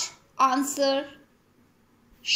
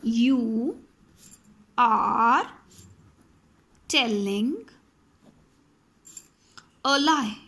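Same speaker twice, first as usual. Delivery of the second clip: each word spaced out slowly; 4 semitones lower, much slower than usual. Shown as a demonstration. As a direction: dictate the sentence slowly to students. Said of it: "If I say".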